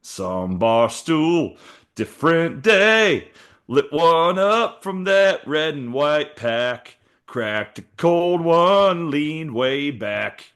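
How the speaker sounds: slow, hammed-up country twang, pitched a bit up; half-sing it like a country ballad, with a Southern accent. Reading his ChatGPT song to his cohost in a mock country accent. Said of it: "I gotta do this in my best country voice".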